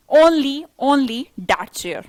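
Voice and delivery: "only, only" hammered slowly and loud; stress on only. Shown as a demonstration. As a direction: lean hard on "only", slow and loud. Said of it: "only का मतलब है stress देके बोल दिया".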